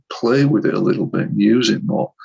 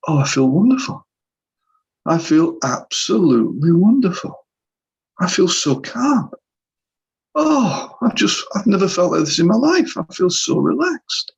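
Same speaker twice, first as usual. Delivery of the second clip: much higher, slowing on calm, amazed relief; blissful relief; 8 semitones higher than usual. Retold or imitated. Client telling therapist he feels wonderful, high amazed relief. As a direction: imitate a client overjoyed at feeling calm.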